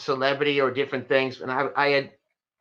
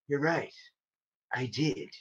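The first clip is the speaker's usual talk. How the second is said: acting tough; 2 semitones lower, 8 dB quieter than usual. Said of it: "act real tough and go".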